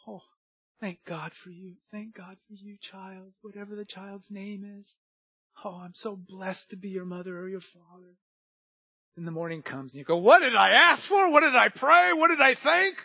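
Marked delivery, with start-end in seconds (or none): 0.1-8.2 tender parent. Retold or imitated. Parent over sleeping child. 10.2-13.0 high, loud mock exasperation; the tired parent at breakfast, fed up; exasperated parent.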